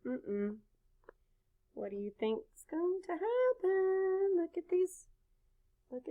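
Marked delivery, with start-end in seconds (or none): none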